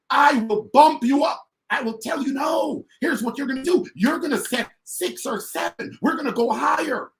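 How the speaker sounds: high, forceful leader's pep talk; emphatic coaching. Acted. Leader to new team member, emphatic coaching. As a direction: push the new recruit upward, pitched high.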